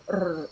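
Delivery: "r" rolled on the tongue; trilled R. Shown as a demonstration. Teacher demonstrating double R, "r" trilled. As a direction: roll the "r" in a trill. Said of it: "is a trilled R sound".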